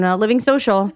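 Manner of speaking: ordinary talk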